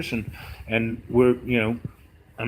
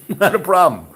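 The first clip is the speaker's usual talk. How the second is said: chuckling through the words; laughing; 6 semitones higher, 8 dB louder than usual. Retold or imitated. Laughing as he agrees to the client's offer. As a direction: laugh it off, louder and higher.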